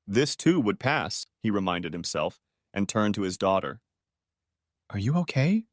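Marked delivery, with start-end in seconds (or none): 4.9-5.6 lightly voiced small child, higher; little girl voice. A narrator's reading. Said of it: "in her thin, trembling baby voice".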